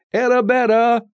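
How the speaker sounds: high, loud searching call. A narrator's reading. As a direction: shout for a missing friend. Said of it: "Doc called out".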